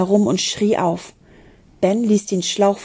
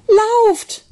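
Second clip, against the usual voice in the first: high, loud urgent yell; shouting; 13 semitones higher, 5 dB louder than usual. A narrator's reading. Julebug urging the children to flee, shouting. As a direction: yell it across the ice. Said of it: "hörte er Julebug schreien".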